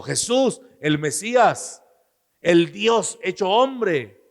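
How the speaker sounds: high, loud proclamation; crying out. Retold or imitated. Blind Bartimeo proclaiming Jesus, high and loud. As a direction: proclaim it, slowing at the end.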